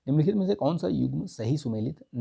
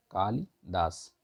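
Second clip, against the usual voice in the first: "कालिदास" बहुत धीरे, खींचकर; "कालिदास" को धीमे-धीमे अक्षर अलग कर बोलो; slow repeat; 4 semitones lower, 3 dB quieter, much slower than usual. Shown as a demonstration. ("Kalidas" धीमी, साफ़ पुनरावृत्ति)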